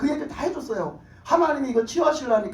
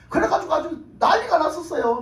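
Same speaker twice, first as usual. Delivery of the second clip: loud emphasis; 6 semitones higher, 5 dB louder than usual. Real, not acted. Repeated line, first loud.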